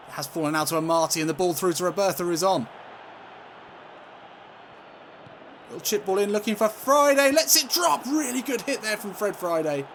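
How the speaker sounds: commentary rising high and loud; excited play-by-play. Real, not acted. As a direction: build with the attack towards the shot. Commentating an attack.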